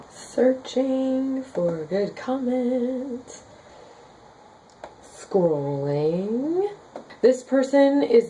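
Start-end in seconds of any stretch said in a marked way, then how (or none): 0.1-6.7 quiet aside to herself. Real, not acted. Muttering to herself while scrolling.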